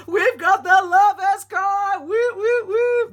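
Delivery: building to loud, high-pitched whooping; excited wand energy. Real, not acted. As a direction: get carried away, stretching out the card name into whoops. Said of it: "Let me just calm my wand energy down".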